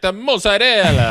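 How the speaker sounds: dad putting on Italian pronunciation; hammed-up Italian food-ordering accent; mock Italian accent. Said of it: "the dad is at the Italian restaurant".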